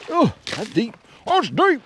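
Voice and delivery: surprised. Real, not acted. Stepping into deep snow.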